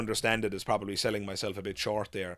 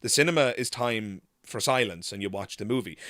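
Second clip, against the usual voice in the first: ordinary talk, nothing marked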